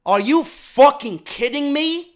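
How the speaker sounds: ordinary talk, nothing marked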